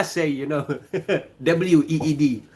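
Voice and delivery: ordinary talk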